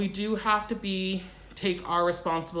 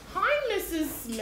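lifted, higher friendly groomer voice; sweet groomer greeting; 9 semitones higher than usual. Acted. Groomer welcoming the owner at check-in, higher and cheery. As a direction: greet the client like a chipper front-desk groomer. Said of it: "I totally talk like that to them".

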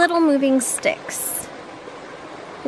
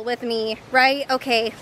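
ordinary delivery

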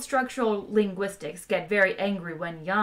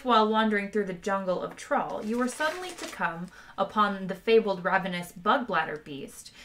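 usual speaking voice

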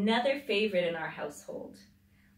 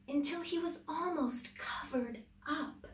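whispered, higher; hushed whisper; 8 semitones higher, 5 dB quieter than usual. A narrator's reading. Reader telling how the fish hides in the sand, soft whisper. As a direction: whisper it as if hiding.

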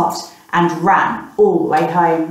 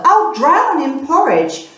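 panicked wail; 8 semitones higher than usual. Acted. Boy panicking in flood.

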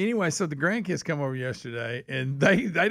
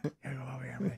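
soft secretive whisper; 2 semitones lower, 11 dB quieter than usual. Retold or imitated. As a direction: call them close in a whisper. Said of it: "trying to tell me a secret".

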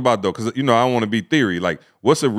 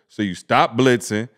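ordinary talk

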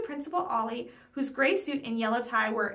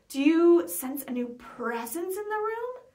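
slow, eerie ghost-hunter tone; spooky ghost-story voice; 5 semitones higher, 3 dB louder, slower than usual. A narrator's reading. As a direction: draw it out like a campfire tale. Friend asks about ghosts.

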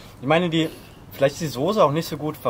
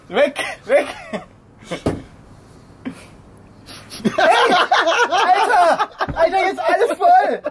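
high, loud laughing yelps of pain; laughing pain cries; 14 semitones higher, 3 dB louder, slower than usual. Real, not acted. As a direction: squeal in burning panic, laughing. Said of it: "Brennt immer noch".

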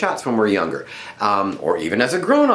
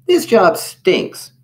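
slow, high, loud grumble; put-out little germ complaining; sulking; 9 semitones higher, 5 dB louder, much slower than usual. A narrator's reading. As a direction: sulk out loud, stretching each word. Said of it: "slumped against a vane and sulked".

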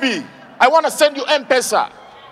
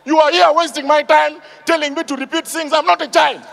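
high, heated mock tirade; getting angry; 4 semitones higher than usual. Auditioner berating judges for wasting his time, high acted anger. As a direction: scold them in acted fed-up indignation. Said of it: "Now he started getting angry".

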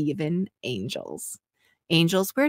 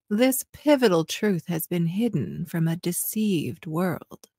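normal talking voice

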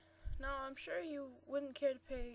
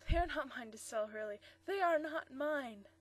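oddly loud; 3 semitones higher, 7 dB louder than usual. Acted. Old dealer speaking loudly for an eavesdropper, oddly loud. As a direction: say it for a hidden listener to hear. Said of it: "he added in an oddly loud tone".

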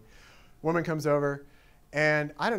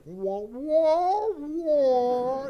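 high muffled-trombone wah noises; Peanuts grown-up wah-wah; 11 semitones higher, 5 dB louder, much slower than usual. Retold or imitated. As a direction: imitate unintelligible cartoon adult speech. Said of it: "it's probably like Peanuts".

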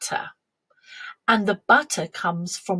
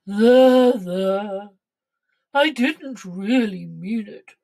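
hint of a consoling cow; drawn "there, there" from a storybook cow; lightly voiced cow; 3 dB louder, slower than usual. A narrator's reading. Cow consoles the dairymaid, lightly mooed.

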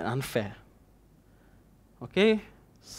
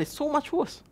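higher mock self-pity whispered; 12 semitones higher, 4 dB quieter than usual. Preacher mocks the self-pitying victim with a high whisper. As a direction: whisper it like a wronged innocent.

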